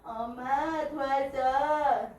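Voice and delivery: put-on a rough go at an older woman calling for help; attempted grandmother voice. A narrator's reading. Grandmother calls her mother for help, lightly imitated. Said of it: "할머니 흉내가 안 되네".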